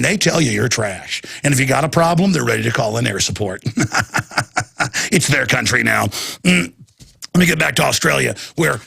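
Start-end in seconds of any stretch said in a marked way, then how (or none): 3.7-5.1 stuttering sinister chuckle; put-on evil laughter; mock villain laugh. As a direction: laugh like a gloating tyrant. Mock villain laugh about armed police.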